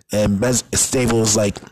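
ordinary talk

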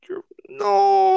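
high, loud wailed refusal; near-tears protest. Retold or imitated. Told by cop to call mom, near-tears protest. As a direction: wail it as if about to cry, hammed up. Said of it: "I was about to start crying".

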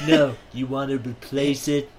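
lightly voiced Trump; Trump impression. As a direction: brag it like Trump. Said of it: "You sound like Trump".

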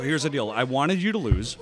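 loud, forceful insistence; loud emphatic. Real, not acted. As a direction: lay it down hard and loud. Chip challenge, firm insistence.

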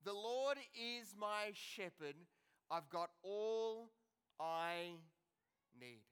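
quiet, slow, letting each word sink in; tender slow recitation; slow, soft reflection. Shown as a demonstration. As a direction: savour every word, gently. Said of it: "let those words sink into our lives".

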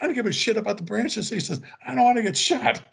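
high-pitched, worked-up imitation of a buddy; agitated retold protest. Scared soldier back at the landing zone, agitated protest. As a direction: push the voice way up, protesting.

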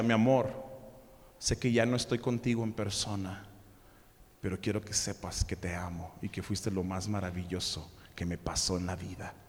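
soft, low, tender reading; tender love note. Acted. Husband's note to his widow, read softly and tenderly. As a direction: read it like a last love letter.

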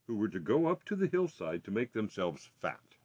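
ordinary delivery, nothing marked

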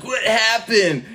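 high, slow whiny "What happened?"; mock puzzled kid. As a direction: play the clueless sick kid. Kid sick from cake.

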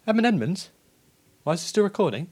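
sharp, high, loud call; alarmed shout. Real, not acted. Panicked call at co-host.

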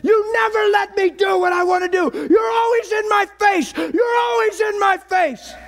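high, strained furious shout; teenage rage yelled at the Bible; retold screaming. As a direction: scream it like a maniac. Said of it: "This is what I said".

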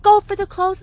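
high, loud imitated scolding; mad sister. Sister orders child back downstairs, imitated angry yell. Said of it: "my sister was all mad".

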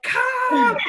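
high, loud mock-desperate holler; hollered plea. Labouring mother calling the doula back, mock-desperate yell. Retelling frantic call to doula.

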